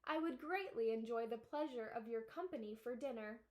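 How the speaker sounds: ordinary delivery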